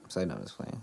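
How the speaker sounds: low, soft throwaway retraction; quiet take-back. Real, not acted. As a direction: mumble it, half to yourself. Takes back his joke.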